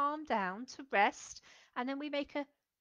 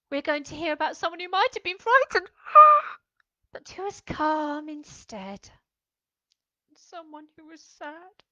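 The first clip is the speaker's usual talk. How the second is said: showing story moods; 4 semitones higher, 8 dB louder than usual. Shown as a demonstration. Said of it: "in our story today".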